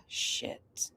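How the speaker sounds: breathy whispered oh shit under the breath; whispered curse. Real, not acted. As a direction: swear under your breath, voiceless. Muttered reacting to message.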